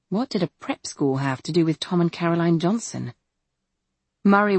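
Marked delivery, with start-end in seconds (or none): none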